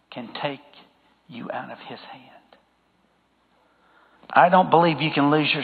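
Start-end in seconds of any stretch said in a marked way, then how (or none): 0.1-2.2 fading to a hush; soft, sure reassurance. Acted. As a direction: let it fall away to near silence. Sermon climax drops soft.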